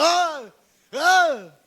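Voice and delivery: high, loud mock roar; devil's yell. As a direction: yell wordlessly like a blustering devil. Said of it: "mahilig sumigaw".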